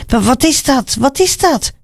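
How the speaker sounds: high-pitched urgent question; calling out puzzled. A narrator's reading. Blind man asks the passing crowd what is happening, calling out. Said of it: "riep Bartimaeus".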